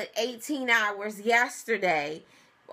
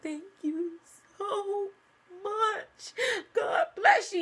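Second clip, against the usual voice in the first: broke down thanking; 9 semitones higher, slower than usual. Retold or imitated. Woman reliving a call with the insurance rep: high teary laugh-cry thanks. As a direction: thank him through tears of relief, half laughing. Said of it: "I broke down".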